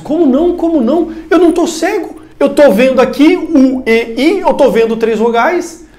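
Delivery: high, loud outraged protest; mock the furious student's disbelief; indignant student. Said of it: "na hora, possesso".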